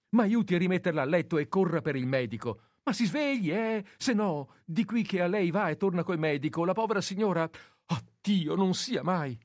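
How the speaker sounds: high, loud breathless urging; urgent pleading. Acted. Nurse urges the nephew to fetch the doctor, high and frantic. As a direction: push him to run for the doctor.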